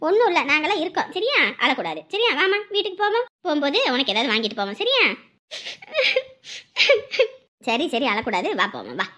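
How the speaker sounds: low, gentle comforting; soothing. Acted. Grandparent comforts crying child before going home, soothing. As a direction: hush a crying child kindly.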